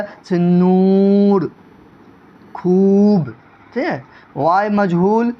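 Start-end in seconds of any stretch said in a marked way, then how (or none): 0.4-3.3 نور، خوب with a very long, loud oo; stretched long oo. Shown as a demonstration. Teacher demonstrates waw maroof, stretched oo vowel. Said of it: "جو واو کھینچ کا پڑھا جائے".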